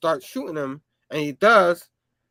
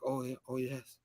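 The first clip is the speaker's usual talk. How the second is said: meek agreement; 6 semitones lower, 14 dB quieter than usual. Retold or imitated. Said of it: "He's like".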